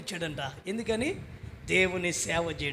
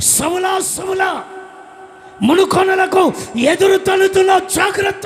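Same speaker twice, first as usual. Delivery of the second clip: much higher, booming call from heaven; voice of God; 11 semitones higher, 16 dB louder than usual. Acted. God calling out to Saul on the road, booming shout.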